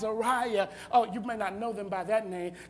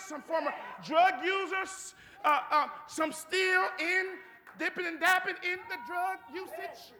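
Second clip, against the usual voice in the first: higher, emphatic sermon delivery; fervent preaching; 5 semitones higher than usual. Real, not acted.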